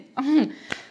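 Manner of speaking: quick high laugh of surprise; short amused laugh. Real, not acted. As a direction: laugh at how cheap it is. Laughs at a surprisingly low price.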